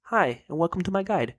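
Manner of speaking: light imitation of a guide narrator; lightly voiced YouTube guide intro; Slayer Music impression. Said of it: "That's my Slayer Music impression".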